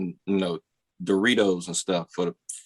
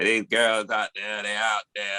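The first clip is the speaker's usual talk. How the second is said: slow robotic drone; slow synthesized-sounding sermon; voice-box pastor. Retold or imitated. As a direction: imitate a preacher speaking through an electrolarynx, drawn out. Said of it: "It sound like he, he got one of those things".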